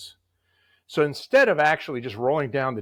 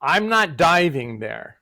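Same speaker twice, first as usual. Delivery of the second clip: loud, slow, higher-pitched refusal; emphatic refusal; 4 semitones higher, 6 dB louder, slower than usual. Retold or imitated. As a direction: dig in and refuse, drawing it out. Retold firm refusal on set.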